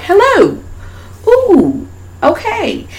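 squeaky revived dolls; high puppet greeting. Retold or imitated. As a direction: pop up sweetly like a puppet. Voicing Tiffany and Chucky.

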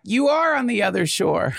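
high-pitched call across a river; teacher half-yelling the punchline back; mock yell back. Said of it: "his teacher yells back".

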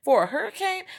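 high, loud disbelief; incredulous question. Retold or imitated. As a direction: ask it like it's absurd. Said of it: "I was like".

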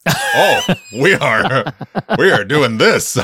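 gleeful dread, laughing through the words; half-laughing realisation that the film goes there. Retold or imitated. Host recalling the garden gnome close-ups, laughing. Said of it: "I was like".

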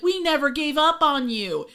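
lightly hammed-up reassurance; mock earnest quote. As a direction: declare it as an overdone pledge. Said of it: "has the audacity to be like".

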